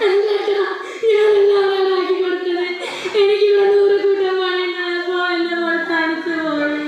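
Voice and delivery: sobbing out the words, voice breaking. Acted. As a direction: plead through tears to the husband. Mother sobbing, blamed by husband. (ഭർത്താവിനോട് കരഞ്ഞുകൊണ്ട് പറയുന്നു)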